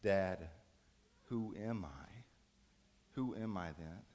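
soft, low, broken-hearted question; hushed, lost and pleading; tearful son. Acted. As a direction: ask Dad quietly, close to tears. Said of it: "looks up with tears coming down his face".

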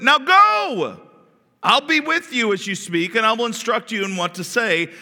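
high, loud, commanding rebuke; stern God. Acted. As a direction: thunder the questions as the Lord. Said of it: "voice from the bush became very stern".

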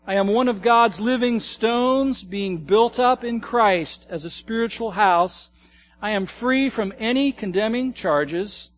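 loud, measured congregational reading; recitation. Acted. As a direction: read each line out as a firm declaration. Congregation reads identity list aloud.

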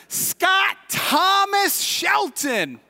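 high, slow shouted full name; calling out. Shown as a demonstration. Preacher singling out a listener by full name, calling out. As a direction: stretch each name into a ringing shout. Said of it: "I just called it out for everybody".

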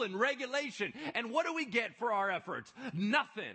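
loud, high self-righteous grievance; mock Pharisee. Preacher voicing the self-righteous Pharisees, loud and mocking. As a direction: play up their indignation.

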